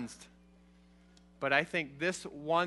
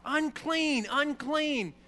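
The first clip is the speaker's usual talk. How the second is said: leper's warning call; 8 semitones higher, much slower than usual. Retold or imitated. Leper warning people on the road away, a high mock call. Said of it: "they needed to shout".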